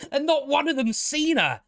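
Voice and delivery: high-pitched incredulous complaint; real exasperated disbelief. Real, not acted. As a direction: protest the absurdity, voice climbing. Exasperated at implausible scene.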